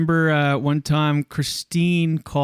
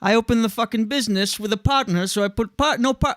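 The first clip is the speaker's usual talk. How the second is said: higher, hammed-up Queens accent; Queens accent attempt; 6 semitones higher than usual. Shown as a demonstration. [name] tries a Queens accent for the group, hammed up. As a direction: stumble through a mock Queens tough-guy line. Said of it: "let me hear yours".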